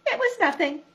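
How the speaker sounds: high, pleased, modest little voice; proud Piglet. A narrator's reading. Piglet proudly telling his friends it was nothing. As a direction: puff up while pretending it was nothing. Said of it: "in his proudest voice".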